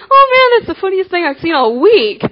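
high, loud mocking glee; hammed-up gleeful jeer, pitched high; mock amusement. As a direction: crow like a boy laughing at someone's fall. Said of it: "he starts laughing".

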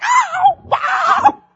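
shouted high-pitched oh wow while running; startled exclamation. Retold or imitated. Running late for the bus, a high shouted oh wow. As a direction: yelp it out at the top of your voice.

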